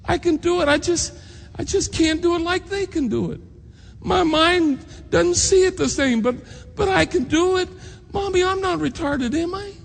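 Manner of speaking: put-on a small boy's high, pleading voice, near tears; distraught child. Young son pleading with his mom after the teacher's insult, high and distraught. Hurt boy pleads with mother.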